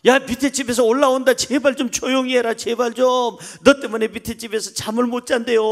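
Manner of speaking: high, pleading telling-off; exasperated parental scolding. Acted. Father scolding his child over downstairs neighbours, high exasperated pleading. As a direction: beg a noisy child to hush, exasperated.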